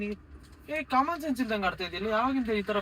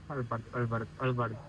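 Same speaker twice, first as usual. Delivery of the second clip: soothing; 7 semitones lower, 6 dB quieter than usual. Real, not acted. Brother soothing crying sister.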